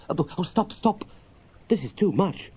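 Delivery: high, breathless protest; overwhelmed Mole. Acted. Mole overwhelmed by the picnic list, high and breathless. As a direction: beg him to stop in delight.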